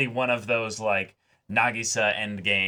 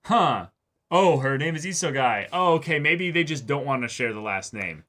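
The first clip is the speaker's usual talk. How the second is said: lightly voiced parents; 5 semitones higher, 7 dB louder than usual. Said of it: "I love that they didn't question".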